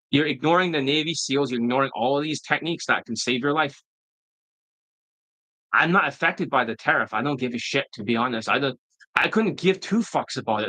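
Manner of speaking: usual speaking voice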